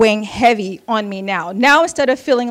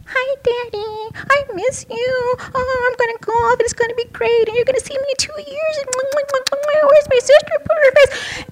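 put-on baby voice. Roommate on the phone to her father in a baby voice. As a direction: coo it like a small child talking to daddy. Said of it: "the most weirdest, babyest voice I have ever heard".

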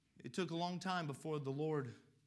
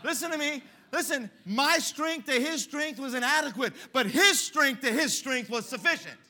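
loud, higher preaching; full-force preaching; 8 semitones higher, 10 dB louder than usual. Acted. Preacher drives the point home loudly to the congregation.

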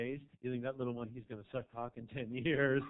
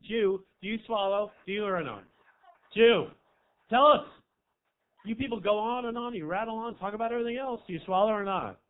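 much louder, higher heckle; badgering shout; 5 semitones higher, 11 dB louder than usual. Real, not acted. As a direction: yell at her to answer. Badgering audience member.

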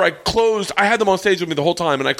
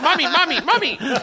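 high mock mommy call; 10 semitones higher than usual. Imitating the Cuban guy.

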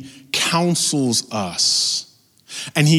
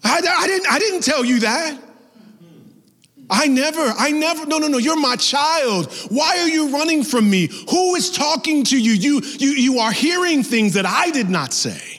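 much higher, impassioned voice of a pleading father; God pleading; 14 semitones higher than usual. Acted. God speaks to his hiding child, tender but urgent and high. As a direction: plead like a loving father whose child runs.

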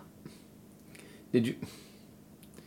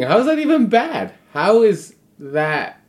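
incredulous; 9 semitones higher, 4 dB louder than usual. Real, not acted. Shocked by the scolding story.

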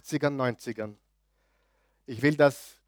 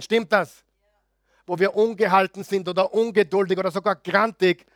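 higher and louder, fervent sermon voice; rally the congregation, voice raised high; preaching at full force; 6 semitones higher, 5 dB louder than usual. Acted. Pastor confessing his impatience to the congregation, preaching loud and high.

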